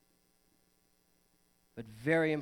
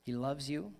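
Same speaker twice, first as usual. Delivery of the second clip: softer, tender pastor's promise; reassuring; 7 semitones lower, 7 dB quieter than usual. Real, not acted. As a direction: gently tell them they belong. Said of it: "reassures the congregation".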